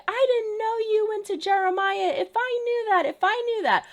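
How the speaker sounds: high-pitched girlish imitation of Belly; imitating Belly. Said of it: "she's like".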